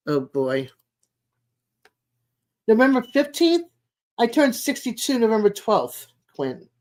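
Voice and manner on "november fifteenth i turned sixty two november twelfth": much higher, louder rising call; light up at the shared date; excited. Real, not acted. Reacts to a viewer's birthday.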